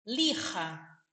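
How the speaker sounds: lija with a throaty J, like a Brazilian R, lixa; Spanish J sound. Shown as a demonstration. Teacher contrasts Portuguese lixa with Spanish Lija, the J like a throaty R. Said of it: "o J aqui tem som de R".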